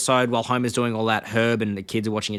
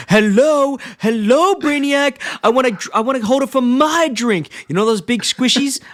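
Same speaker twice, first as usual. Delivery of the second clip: very high, louder mock-bossy yelling; screaming orders; 12 semitones higher, 5 dB louder than usual. Homer demanding a drink holder from engineers, hammed-up screamed orders. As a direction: imitate an impatient customer yelling demands. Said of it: "just screaming his orders".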